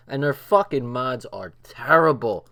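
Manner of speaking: ordinary talk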